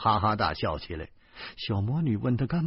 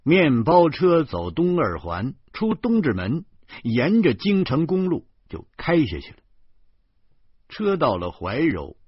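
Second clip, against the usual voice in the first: plain reading voice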